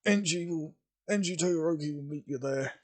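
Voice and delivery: weak, halting dying man; fading last words, stammering. Acted. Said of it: "gonna have to try and do a dying voice here".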